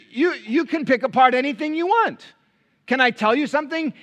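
ordinary talk

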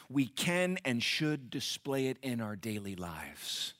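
ordinary delivery

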